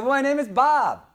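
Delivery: loud, high called-out answer; yelled back. Retold or imitated. Embarrassed customer yelling back to the owner, loud. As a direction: shout your name back across the room. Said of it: "And I yelled back".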